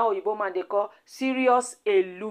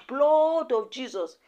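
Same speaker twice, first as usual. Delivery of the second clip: drawn-out mock cry, pitched up; retold shout; 4 semitones higher, slower than usual. Queen May calls on Jesus against her attackers, mock shout. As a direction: stretch it out slowly like a mild retold shout. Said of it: "Queen May just shout".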